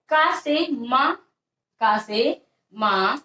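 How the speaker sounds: का से मा drawn out slowly with pauses; slow dictation. Shown as a demonstration. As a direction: stretch out का से मा for the class. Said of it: "दंत सा". (धीमी लिखवाई)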